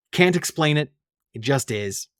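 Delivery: ordinary talk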